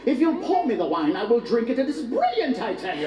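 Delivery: mock-proper English party voice; British accent. Briton at a party, same proper British accent. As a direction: revel in a prim British accent, swooping up. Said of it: "When they party".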